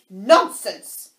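loud, firm dismissal; loud and decided. A narrator's reading. Alice silences the Queen, loud and decided. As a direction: cut them off loudly and decidedly. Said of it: "said Alice very loudly and decidedly".

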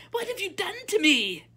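very high, loud princess squeal; outraged high-pitched squeal. A narrator's reading. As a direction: shriek it at the top of your range. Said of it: "squealed the princess".

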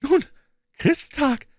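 put-on very high boy's voice; Knabenstimme. A narrator's reading. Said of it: "antwortete der Knabe". (sehr hohe Knabenstimme)

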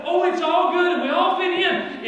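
mock breezy religion voice. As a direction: parody the easy-going churchgoer. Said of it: "It's like".

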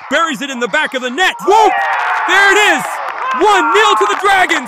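high, loud goal call; long goal yell. Real, not acted. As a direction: build into a roaring goal call.

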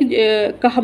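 plain reading voice

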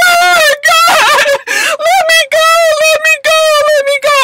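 high, loud sobbing plea, slowing; mock crying wail. She begs the choir girls to let her go, crying loudly. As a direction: wail it like you're being held back.

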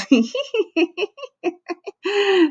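very high, tittering acted giggle. A narrator's reading. Victoria catches the joke after Calvin, giggling high. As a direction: giggle in a rapid string of high hee-hees. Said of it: "And then Victoria giggled".

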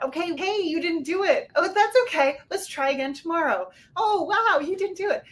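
high, bright, loud cheering voice; cheerleader. Retold or imitated. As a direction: cheer them on like a peppy cheerleader. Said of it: "used to be a cheerleader".

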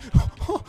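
quick high laugh of disbelief; high nervous laugh. Real, not acted. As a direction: laugh it off in panic. Nervous laugh mid fight.

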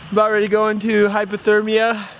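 shivering. Real, not acted. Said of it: "I'm really cold".

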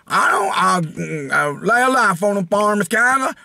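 caricatured yokel breaking into a silly whoop. Acted. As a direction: play an uneducated farm simpleton, hammed up. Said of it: "I'm not that, that guy".

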